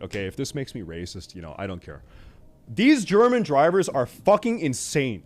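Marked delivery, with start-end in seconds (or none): 2.7-5.2 louder and higher, every word hammered; emphatic declaration. Shown as a demonstration. To the viewers about German drivers, a loud emphatic rant. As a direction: declare it boldly like a verdict. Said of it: "I'm just gonna say it".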